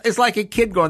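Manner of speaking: normal talking voice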